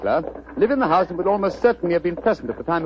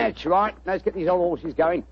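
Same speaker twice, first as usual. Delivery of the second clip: chirpy cockney delivery pitched up; cockney disguise. Acted. Disguised detective greets the homeowner in cockney. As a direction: play a cheeky London workman. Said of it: "That's rhyming slang".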